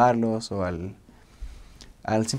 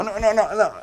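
high, rapid run of refusals; rechazo exagerado; 10 semitones higher than usual. Acted. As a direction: act out recoiling from a kiss. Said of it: "de hacer como". (rechazo agudo y exagerado)

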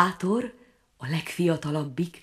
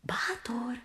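soft breathy call of his name; a small frog whispering his name; whispered call; 6 semitones higher, 12 dB quieter than usual. A narrator's reading. Frog calls the prince.

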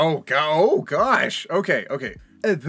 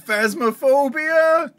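excited; 8 semitones higher, 7 dB louder, slower than usual. Real, not acted. Excited recognition.

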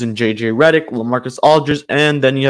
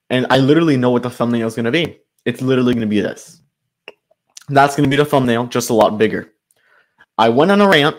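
usual speaking voice

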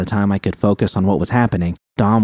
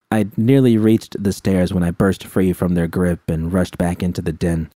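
ordinary delivery, nothing marked

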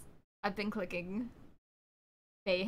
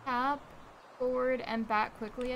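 ordinary talk

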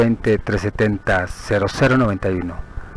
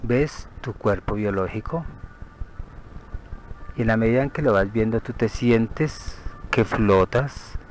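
guided meditation; 6 dB quieter than usual. Acted. Guide leading a listener to picture the body from outside, soothing meditation. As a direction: lead them gently through the visualization, unhurried.

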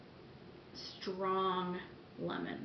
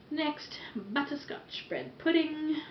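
put-on slow, higher playful English accent; random English accent; 8 semitones higher, slower than usual. Announcing her next wax tart in a silly English accent. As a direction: toss off a mock English accent as a joke. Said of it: "I don't know why I do random English accents".

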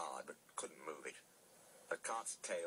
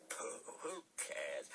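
high, slow dismissive pooh; drawn-out scornful bluster; scoffing; 8 semitones higher, much slower than usual. A narrator's reading. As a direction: brush it off with bravado. Thomas scoffs at the ghost story.

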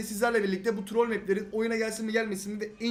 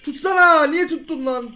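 loud high snap; angry shout; 6 semitones higher, 9 dB louder, slower than usual. Real, not acted. Gamer shouting at his teammate after the goal, loud high shout. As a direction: shout it in disbelief.

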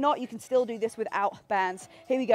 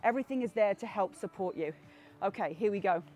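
lower, softer encouraging coach; soft reassuring; 5 semitones lower, 7 dB quieter than usual. Real, not acted. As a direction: ease them gently along.

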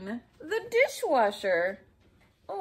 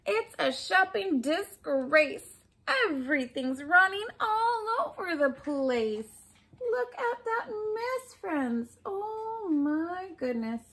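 mildly mad; 6 semitones higher than usual. Acted. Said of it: "That means she's mad".

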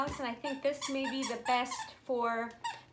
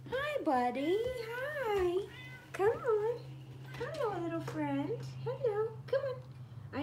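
much higher cooing to the cat; 9 semitones higher, slower than usual. Real, not acted. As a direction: coo and coax the kitty in. Greeting her cat.

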